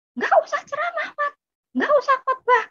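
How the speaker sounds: very high-pitched angry rebuke, imitating older sibling; snapping shout. Older sibling snaps at preaching younger one, high angry shout. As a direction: cut them off in a shriek. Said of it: "saya dibentak lagi sama kakak saya".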